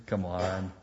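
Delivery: usual speaking voice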